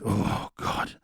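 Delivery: usual speaking voice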